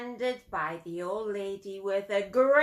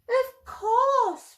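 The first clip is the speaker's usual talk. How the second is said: put-on eager boy; 13 semitones higher, 7 dB louder than usual. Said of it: "replied the boy".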